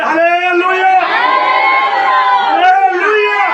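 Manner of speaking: loud, high, drawn-out hallelujah shout. Real, not acted. Pastor leading the congregation, loud long hallelujah. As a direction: call it out loud to lead the crowd. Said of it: "जोर से बोले".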